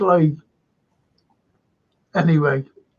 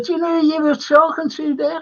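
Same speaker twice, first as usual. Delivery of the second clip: higher, quick teasing question; mate's question; 9 semitones higher, faster than usual. Retold or imitated. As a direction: pitch it up and tease. Mate voiced in retold scene.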